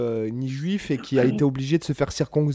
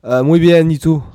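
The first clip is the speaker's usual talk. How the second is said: loud Spanish reply; 2 semitones higher, 9 dB louder than usual. Pretend. Answers in Spanish, loudly.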